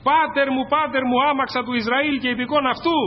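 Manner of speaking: high-pitched preacher's cry; impassioned quoted outcry, pitched well up. Acted. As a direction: call out after someone being taken away. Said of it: "το βλέπε και φώναζε".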